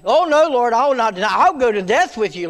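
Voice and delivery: loud, high, earnest vow; Peter protesting. Acted. Peter vowing to Jesus, loud earnest protest. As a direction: protest like a man swearing loyalty.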